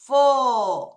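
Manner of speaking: "for" held long and falling, on its own; syllable drawn out. Shown as a demonstration. Teacher dictates the last part to pupils, long held "for". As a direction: stretch "for" as a separate syllable. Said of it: "음절을 잘 끊어야 되죠".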